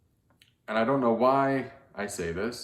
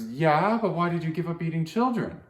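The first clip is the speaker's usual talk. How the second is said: put-on higher, curious child's voice; little girl voice; 7 semitones higher than usual. Little girl presses the narrator about eating children, higher curious child voice. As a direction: ask it like a puzzled small girl.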